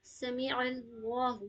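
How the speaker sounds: سمیع اللہ said slowly, اللہ given full, unstretched; recitation demo. Shown as a demonstration. Teacher shows students how to say اللہ. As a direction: say اللہ fully without stretching. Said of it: "اس میں کسی میں بھی کھینچنا نہیں ہے".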